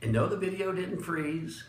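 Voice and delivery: usual speaking voice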